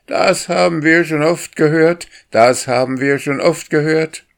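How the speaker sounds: high, loud call through hands; calling through cupped hands. A narrator's reading. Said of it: "hielt er die Hände wie ein Horn vor den Mund und rief".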